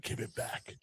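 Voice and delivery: hissed under the breath; whisper. Retold or imitated. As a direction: whisper it sharply. Randall whispers angrily.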